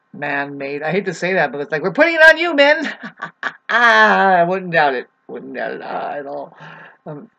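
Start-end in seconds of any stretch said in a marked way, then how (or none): 1.8-3.0 much louder, high-pitched mock blame; mock accusing shout. Speaker playfully blames men for illness, mock accusing shout. 3.0-3.7 short laugh after a joke. Real, not acted. Speaker laughs at blaming men, amused laugh. 3.7-5.1 loud, slow, drawn-out exclamation. Real, not acted. Speaker reacts to own joke about men, loud exclamation.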